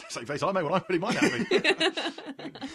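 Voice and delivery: chuckling through it; laughing. Real, not acted. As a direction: laugh while saying it. Joking, laughing.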